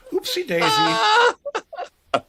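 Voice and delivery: very high, slow, squealing cry; squealed oopsie daisy. Acted. Squealing at his cohost in mock panic.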